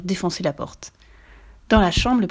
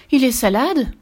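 higher, louder worried question; worried mother; 6 semitones higher, 5 dB louder than usual. A narrator's reading. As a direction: ask it as a concerned mum. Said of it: "s'inquiéta la maman". (maman inquiète)

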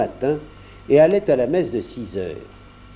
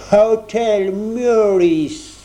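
put-on very slow, drawn-out English accent, higher and louder; stiff, solemn Englishman in a thick English accent; 7 semitones higher, 4 dB louder, much slower than usual. A narrator's reading. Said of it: "prit un accent anglais très prononcé et très solennel". (accent anglais très prononcé et solennel, très lent)

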